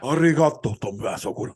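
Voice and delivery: put-on playful Japanese accent; mock Japanese accent. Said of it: "It does sound very Japanese".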